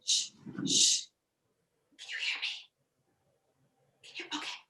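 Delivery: unvoiced stage whisper; leaning in to whisper. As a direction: hush as if a baby sleeps nearby. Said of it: "I have to whisper".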